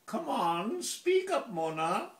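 high, swooping plea; theatrical entreaty in a poem; imploring. Acted. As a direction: coax her insistently, pitch leaping up. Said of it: "I would implore her".

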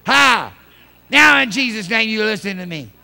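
loud, commanding pulpit voice; forceful preaching. Real, not acted.

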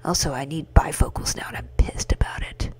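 whispering the game dialogue; whispered read. Acted. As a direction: read the lines in a hush. Reading game dialogue hushed.

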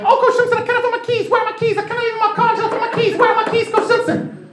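very high, frantic falsetto plea; Zambian mom impression. Mother bursting into the coach's office, high frantic falsetto. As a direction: squeak it out, panicked about the keys. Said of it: "I am doing a perfect impression of one woman".

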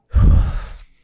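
loud unvoiced sigh, fighting back tears; father overcome recalling his son's call, sighing; heavy shaky sigh. Real, not acted. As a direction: let out a big breath to steady yourself. Choking up retelling son's call.

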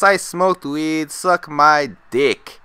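slow, drawn-out cocky proclamation; deliberate retold announcement; announcing to class. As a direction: stretch each word out as a public boast. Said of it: "I announced all class".

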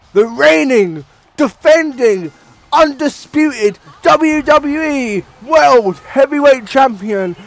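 slow, drawn-out ring introduction shouted high and loud; booming slow-building champion intro; Paul Heyman impression. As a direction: hype the champion like his advocate, stretching every title. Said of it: "Can't do it as well as Paul Heyman".

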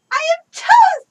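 high triumphant shriek; excited scream. Real, not acted. Triumphant yell at being toast.

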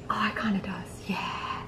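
soft conspiratorial whisper. Real, not acted. Prankster whispers to camera so Brandon won't hear. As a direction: hush it so nobody hears.

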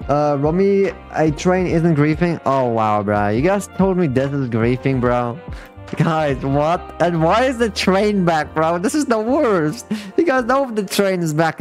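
normal talking voice

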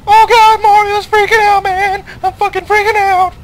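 high, loud panicked yelling; freaking out. Real, not acted. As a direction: shriek like it's all over. Boss music starts, panicking.

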